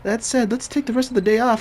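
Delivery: a high cheerful companion voice; bright character voice. Acted. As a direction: lift up high and play her cheerfully. Voicing a female party member.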